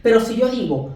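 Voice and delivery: ordinary talk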